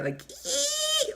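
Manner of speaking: a piercing high squeal mocking the guest's voice; mock shriek. Host mocks the guest's accent with a high squeal. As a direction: squeal like nails on a chalkboard. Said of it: "like a shrieking sound".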